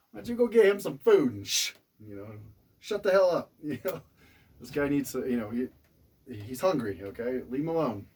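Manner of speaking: high, loud frantic urging; frantic pleading. Retold or imitated. Husband begging his wife to leave the guy alone, frantic. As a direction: beg her to back off, panicked and loud.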